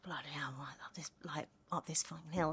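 soft, half-whispered grumble under the breath; muttered grumble. Retold or imitated. Actor recalling her grumble about a hill in rehearsal, muttered softly.

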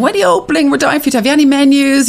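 high, fast eager voices; excited customers. Retold or imitated. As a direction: gush like a customer dying for it.

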